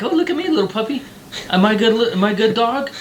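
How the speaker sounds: high fast mock plea; needy dog voice. Dog begging a puppy for approval, high mock whine. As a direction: beg for approval in a silly high voice. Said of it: "the dog's like".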